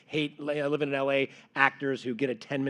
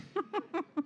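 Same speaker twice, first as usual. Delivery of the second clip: high laugh; 8 dB quieter than usual. Real, not acted. Laugh after wishing for accent.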